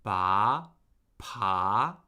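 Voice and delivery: "Ba" and "pa" starting low and rising; second tone. Shown as a demonstration. Teacher drilling the second tone, rising pitch on "Ba, pa". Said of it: "the rising tone".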